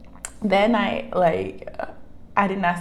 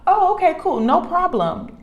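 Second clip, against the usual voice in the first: high, loud, breezy agreement; imitating carefree workers, loud and upbeat; unbothered exterminators; 11 semitones higher, 8 dB louder than usual. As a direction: chirp it loudly as if it's nothing. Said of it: "too calm about this".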